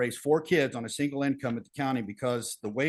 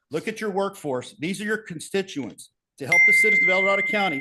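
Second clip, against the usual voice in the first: higher and louder, rising to a rallying call; impassioned appeal; 6 semitones higher, 7 dB louder than usual. Real, not acted. Highway supervisor rallying the county board for his crew, impassioned and loud. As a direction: call the board to look at the workers in front of them.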